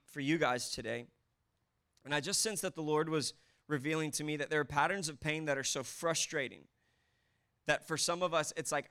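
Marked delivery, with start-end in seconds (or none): none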